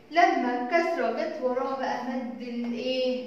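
normal talking voice

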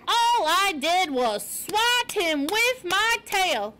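high, slow, emphatic character voice; indignant porcupine. A narrator's reading. Porcupine denying to Poppy that he ate fox, indignant. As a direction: stretch the words in haughty outrage.

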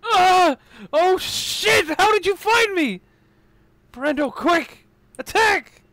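high, loud panicked character yelling; panicked shout. Acted. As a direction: scream in terror as the attack comes. Character attacked by infected Harold.

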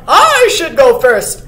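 loud, assertive claim; young buck. A narrator's reading. Young buck demanding to go first, loud. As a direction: push to the front with the voice. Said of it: "said the young buck".

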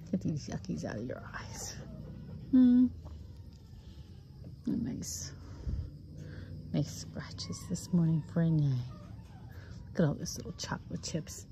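hushed fond whisper. Real, not acted. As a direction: whisper gently while grooming. Soft grooming talk to dog.

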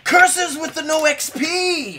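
high, loud, slow mock lament; mock curse. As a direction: shout it like a cursed villain. Mock lament over a lost chance.

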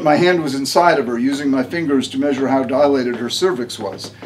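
ordinary delivery, nothing marked

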